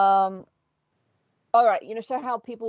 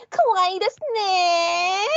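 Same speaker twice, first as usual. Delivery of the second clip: much higher, slow, screeching anime fangirl; 12 semitones higher, 4 dB louder, much slower than usual. Retold or imitated. Embarrassing a friend at a convention, high screeching fangirl. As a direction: shriek it across the hall to embarrass a friend. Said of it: "high-pitched screeching fangirl".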